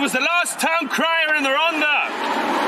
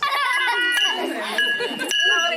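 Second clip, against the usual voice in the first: high laugh; 9 semitones higher than usual. Real, not acted. Teasing announcement about Molly.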